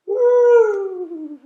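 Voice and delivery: loud, high whoop of joy; a loud joyful woo; excited whoop. Acted. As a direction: cheer like riding a pumpkin downhill. Penguins riding in a pumpkin.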